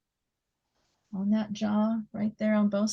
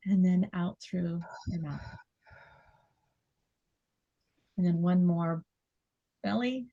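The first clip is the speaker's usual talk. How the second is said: guided breathing. Acted. Guiding breathing, softer.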